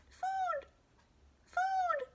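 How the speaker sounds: very high squeaky chick cries; tiny hatchling begging for food; hungry gosling peeps. Acted. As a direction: peep for food like a newborn.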